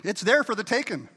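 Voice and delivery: loud, emphatic proclamation; preaching shout. Real, not acted. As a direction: call it out to the church.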